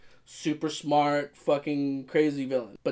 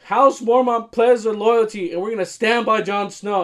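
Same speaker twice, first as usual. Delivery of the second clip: put-on girl queen proudly pledging loyalty; proud young queen; 7 semitones higher, 7 dB louder than usual. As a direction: declare it with proud resolve. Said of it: "She's like".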